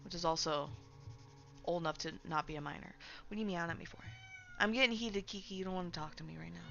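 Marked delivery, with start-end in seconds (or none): 3.3-6.6 talking to pet. Real, not acted. Talking to her meowing cat.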